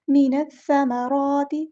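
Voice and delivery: मीनस समाराती recited slowly, vowels drawn long; slow tajweed recitation. Shown as a demonstration. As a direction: chant मीनस समाराती slowly with a clear सीन. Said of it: "इस तरह पढ़ना". (तजवीद के साथ धीरे-धीरे पढ़ना)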